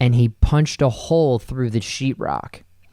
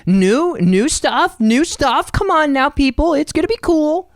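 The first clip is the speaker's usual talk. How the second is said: much higher, playful hype; excited high hype voice. Pretend. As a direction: squeak it out like an eager cheerleader. Hyping the podcast in a high voice.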